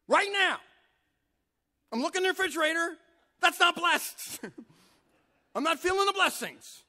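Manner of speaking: high, loud, hammed-up griping at God; a comic believer shouting about his empty fridge; whiny shouted complaint. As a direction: complain to God like a cheated kid.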